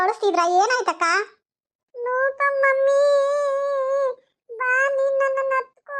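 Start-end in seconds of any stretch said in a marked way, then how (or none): 2.0-6.0 put-on high, very slow little girl begging; pleading toddler. Toddler begging her aunt to carry her, high slow plea. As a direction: stretch it out like a clingy two-year-old.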